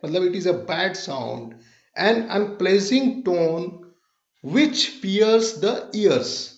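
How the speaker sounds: very slow, drawn-out words; slow teaching definition. Shown as a demonstration. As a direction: stretch each word so it sinks in. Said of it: "teacher slowing down to define". (बहुत धीमी, खींचकर परिभाषा)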